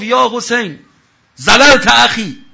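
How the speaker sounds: loud, slow, furious battle cry; enraged warrior yelling across the field; angry shout. Retold or imitated. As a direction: bellow it at the enemy, drawn out. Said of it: "داد زد، یا عصبانی هم بود".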